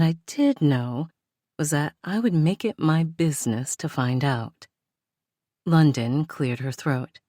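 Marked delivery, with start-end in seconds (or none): none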